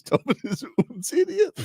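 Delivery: high mock German voice; imagined German heckler. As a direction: pitch it up as a mocking German. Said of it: "Should be the Schmelly says".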